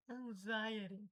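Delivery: "anxiety" slowly, as ang-ZAI-eri. Shown as a demonstration. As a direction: say "anxiety" with Z and a tapped T. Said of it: "o X tem som de Z". ("anxiety" devagar, X com som de Z)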